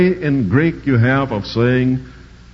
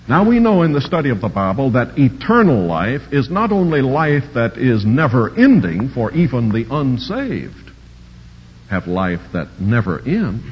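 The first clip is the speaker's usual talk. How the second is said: ordinary talk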